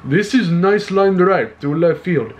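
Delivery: playful Russian accent imitation. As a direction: repeat the call in a hammed-up Russian accent. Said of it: "That sounded like a Russian accent".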